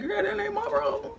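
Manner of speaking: high, laughing brush-off; laughing dismissal. Retold or imitated. Telling her friend it's not her problem, laughing.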